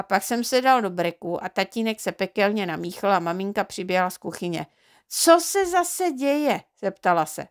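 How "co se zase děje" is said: much higher, slow, drawn-out mother's question; exasperated mother. A narrator's reading. Mother running in from the kitchen, exasperated and slow. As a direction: sigh it out as a fed-up mum.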